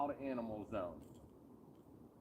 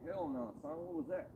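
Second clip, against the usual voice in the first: a hint of a startled beaver, pitched up; lightly voiced spooked beaver; 4 dB quieter than usual. Angler voices the beaver reacting to the owl, lightly pitched up. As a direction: toss it off like a startled critter. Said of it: "That beaver was like".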